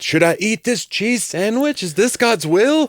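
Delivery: high, slow, hammed-up worry; mock terrified. Anxious believer agonizes over God's will, mock terrified. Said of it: "you're terrified".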